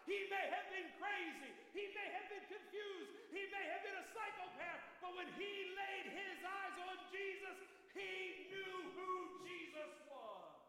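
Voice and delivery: high, slowing shouted climax; preaching climax. Acted. Preacher's peak to the congregation, high and slow. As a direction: cry it out and draw it long.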